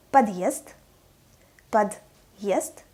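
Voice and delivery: "подъезд" with hard д, then a y-glide; hard sign separation. Shown as a demonstration. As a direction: split "подъезд" as pod-yezd. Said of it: "separate the consonant and the vowel".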